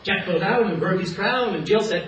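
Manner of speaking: ordinary talk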